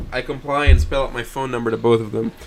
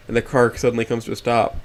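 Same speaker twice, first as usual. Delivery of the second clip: ordinary delivery, nothing marked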